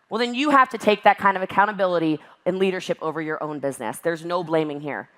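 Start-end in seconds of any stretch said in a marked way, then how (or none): none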